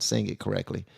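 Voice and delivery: ordinary talk, nothing marked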